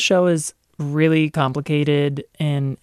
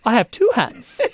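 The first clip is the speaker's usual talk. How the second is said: higher, hammed-up come-on; mock seducer; 6 semitones higher than usual. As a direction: play along with a silly stilted seduction. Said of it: "saw the scene that you mentioned".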